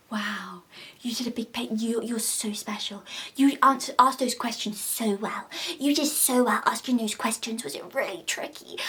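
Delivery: put-on hushed, talking to a toddler; patronising whisper. Vlogger mocking interviewers, whispered baby talk. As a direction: coo softly like to a small child. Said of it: "talking to her like she's a toddler".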